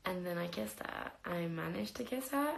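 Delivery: ordinary talk, nothing marked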